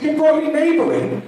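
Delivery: high, shocked disbelief; a doctor appalled at his patient; horrified doctor. Acted. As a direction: recoil at the idea. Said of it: "The doctor was horrified".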